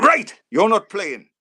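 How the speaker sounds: loud, high, slow announcement; blunt gaffer's shout. Retold or imitated. Manager dropping a player before kick-off, shouting. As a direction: bellow the verdict deliberately.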